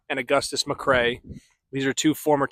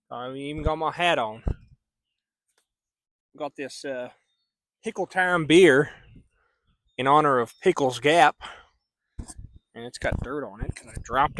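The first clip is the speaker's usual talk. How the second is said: put-on cowboy accent. Vlogger hosting his book review in a mock cowboy accent. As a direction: play a folksy Texas cowboy host, hat on and toothpick in. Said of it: "I'll drop the accent".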